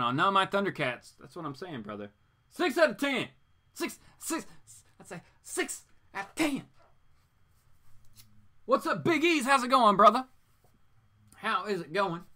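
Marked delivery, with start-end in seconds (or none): 2.5-6.6 very high, squeaky score call; high-pitched mock outburst; comic exclamation. 8.6-12.2 high, loud playful hello; hyped-up, high-pitched welcome; exuberant greeting. Pretend.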